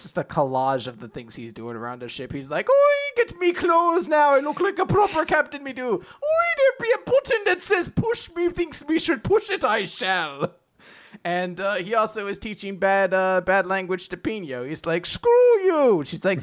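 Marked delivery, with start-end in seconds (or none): 2.7-10.5 very high, loud mock Irish; hammed-up Irish squawk, falling at the end; Captain Connor. Captain busies himself around the ship, hammed-up Irish. 15.1-16.0 very high, loud mock Irish; Captain Connor. Captain teaches bad language to Pino, mock Irish.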